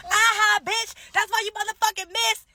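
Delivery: high, gloating mock yell; taunting yell. Woman yelling back at the shooter who missed, taunting yell. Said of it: "I'm gonna yell".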